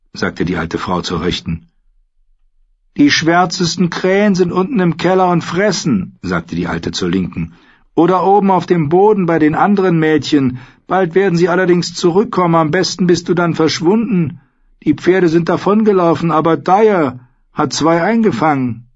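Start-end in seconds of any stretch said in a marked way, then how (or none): 3.0-6.2 put-on high-pitched, loud old woman; old woman's raised voice. 7.9-18.9 put-on high-pitched, loud old woman; old woman's raised voice.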